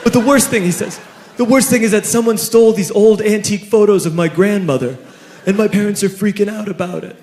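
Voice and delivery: a lightly voiced distressed teenager; upset teen host. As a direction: vent it like a kid who's in trouble with his parents. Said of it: "he said".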